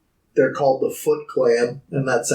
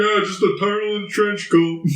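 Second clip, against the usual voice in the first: put-on casual voice, pitched up; nonchalant; 5 semitones higher than usual. Taxi driver who has seen everything, nonchalant. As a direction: imitate an unfazed cabbie. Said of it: "not so nonchalantly".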